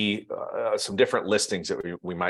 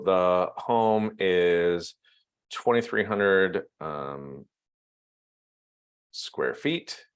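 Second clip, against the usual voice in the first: very slow, halting dictation to oneself; typing aloud; 3 semitones lower, much slower than usual. Real, not acted. Trainer types home details, slowly speaking along. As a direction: stretch each word as you type.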